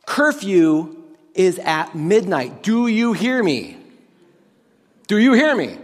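loud, emphatic parent laying down the law; stern parent. Acted. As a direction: lay down the rule like a parent. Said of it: "if you've ever been a parent".

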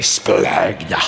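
splagna said low and drawn, SPLAG-na; Greek word splagna. Shown as a demonstration. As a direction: say splagna slowly with the stress on SPLAG. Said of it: "Say that with me".

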